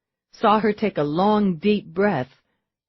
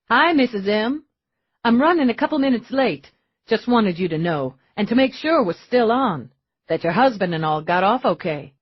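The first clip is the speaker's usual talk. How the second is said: put-on higher, bright boyish voice, a bit rushed; eager young man; 4 semitones higher, 4 dB louder than usual. Denny calling Missus M to say he's late, eager. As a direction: play the friendly polite young visitor.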